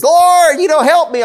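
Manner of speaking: high, loud pleading whine; whiny voice. Retold or imitated. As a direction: whine it out like a nagging petitioner. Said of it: "Maybe she had one of those whiny voices".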